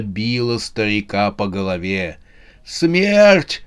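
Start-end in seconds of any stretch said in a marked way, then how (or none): 2.6-3.6 high-pitched desperate call, a little louder; old man calling out in a much higher voice; crying out. A narrator's reading. Rich old man begging Death for help, crying out. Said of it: "кричал он".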